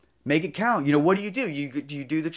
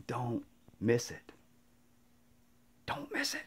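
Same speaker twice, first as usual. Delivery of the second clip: hushed urging; 5 semitones lower, 12 dB quieter than usual. Retold or imitated. Older friend urging the younger one, in a hush. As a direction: press it on him in a hush.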